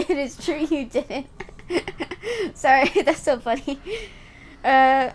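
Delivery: laughing through the words; giggling while reading. Real, not acted.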